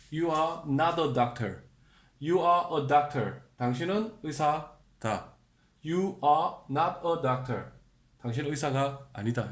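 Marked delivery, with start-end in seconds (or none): 0.1-1.6 slow model sentence. Shown as a demonstration. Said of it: "are를 씁니다". 2.2-3.4 slow model sentence. Shown as a demonstration. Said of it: "are를 씁니다". 5.9-7.7 slow model sentence. Shown as a demonstration. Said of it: "are를 씁니다".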